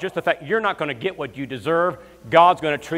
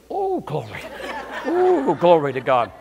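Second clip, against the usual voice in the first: mock-rapturous, pitched up on oh; ecstatic oh glory; 2 semitones higher, slower than usual. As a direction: ham up the groom's rapture. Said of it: "He goes".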